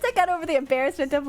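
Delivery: ordinary talk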